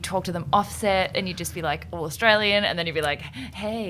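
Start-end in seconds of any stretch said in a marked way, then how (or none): 3.2-3.9 soft imitation American "hey"; lightly put-on American greeting; American accent. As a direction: switch into a casual American accent. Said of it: "and the American accent".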